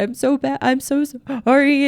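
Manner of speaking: high-pitched tearful wailing apology; mock crying. Wife crying when her husband brings up something, mock tearful. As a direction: imitate a wife bursting into emotional tears. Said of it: "start crying of like".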